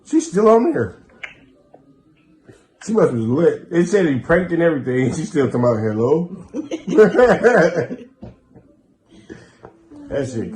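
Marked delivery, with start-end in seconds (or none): 0.1-6.4 loud, high and gleeful; excited disbelief. Real, not acted. Host telling friends the victim is still talking, loud and gleeful. 6.5-8.3 loud high laughter. Real, not acted. Victim still saying hello.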